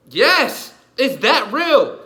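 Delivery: high, loud, slow insistence; emphatic shout. Real, not acted. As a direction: slam it home to the doubter. Insisting to doubting listener.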